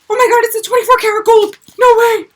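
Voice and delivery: high fast squeal of delight; shrieking jackpot winner. Real, not acted. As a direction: squeal like you hit the jackpot. Finds gold palette, gasps.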